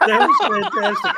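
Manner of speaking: laughing. Real, not acted. Friend replaying the stunt, laughing hard. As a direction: crack up while saying it.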